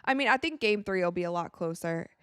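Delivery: ordinary delivery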